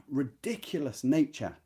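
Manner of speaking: ordinary talk, nothing marked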